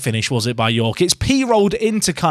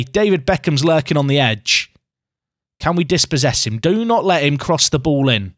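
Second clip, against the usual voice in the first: normal talking voice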